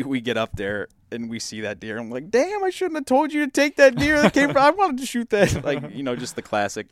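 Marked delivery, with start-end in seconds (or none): 2.3-5.6 much higher and louder, teasing whine; hammed-up envy to rib your buddy; mock jealous complaint. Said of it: "giving each other some crap".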